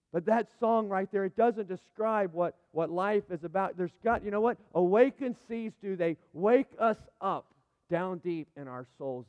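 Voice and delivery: impassioned sermon delivery; fervent preaching. Real, not acted. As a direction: preach it home with full force. Preaching at full force.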